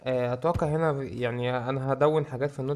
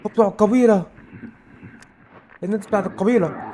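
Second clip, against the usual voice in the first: loud, high-pitched cry of alarm; frightened yell, pitch jumping up; real fear; 7 semitones higher, 7 dB louder than usual. Real, not acted. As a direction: call out in panic as danger appears. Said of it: "انا مرعوب".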